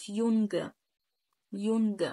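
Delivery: يونج opening on a y, "yunge"; Y sound. Shown as a demonstration. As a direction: model يونج slowly with an initial y glide. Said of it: "حرف الياء أو صوت اليه". (نطق يونج بصوت الياء)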